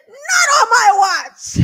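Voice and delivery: loud, high, stretched-out mock yell; hammed-up defiant shout. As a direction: holler it slowly like a dramatic heroine. Acting out Farrah's defiant outburst.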